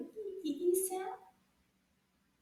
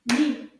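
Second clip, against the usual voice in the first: "Nid" as one loud beat; single-syllable beat; 10 dB louder than usual. Shown as a demonstration. Teacher claps the syllables with children, "Nid" loud and single. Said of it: "Can you clap the syllables?".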